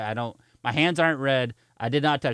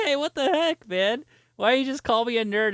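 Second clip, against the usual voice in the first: high-pitched offended protest; mock indignation; 9 semitones higher than usual. Storyteller objects to being called a nerd, mock indignant. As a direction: protest the label in a raised, put-on voice.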